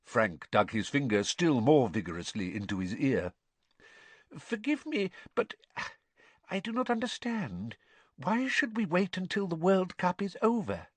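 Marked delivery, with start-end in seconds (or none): 4.4-10.9 high, timid, apologetic servant voice; Wormtail. A narrator's reading. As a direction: plead a question to your master in a high voice. Narrator voices timid Wormtail questioning his lord.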